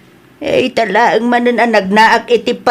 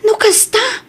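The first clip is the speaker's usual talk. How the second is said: high, tearful question; crying disbelief; 8 semitones higher than usual. Acted. Daughter learns animals were gambled.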